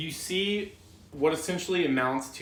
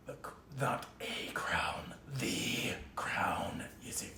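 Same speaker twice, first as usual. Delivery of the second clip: creepy whisper; 4 semitones lower, 9 dB quieter than usual. Acted. Snake whispers.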